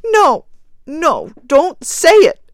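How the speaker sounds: high, loud, frantic cry; wild cry. Acted. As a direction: cry it out wildly. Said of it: "cried the woman wildly".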